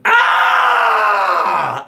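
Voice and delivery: short mock baby yell; newborn scream. A newborn screaming out at birth, mock yell. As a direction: let out a brief baby's cry. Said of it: "And you scream out".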